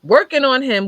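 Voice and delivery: high, loud alarmed question; alarmed repeat. Retold or imitated. Wife realising her husband is being resuscitated, loud and alarmed. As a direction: push it out in rising panic. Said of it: "I said".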